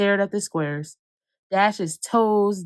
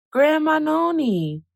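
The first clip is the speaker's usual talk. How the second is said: excited call; 8 semitones higher than usual. Acted. Excited child spots grandma.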